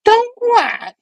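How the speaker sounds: very high, squealing villain retort; Goblin. Acted. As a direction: squeal it back as a cartoon villain. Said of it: "Goblin was like".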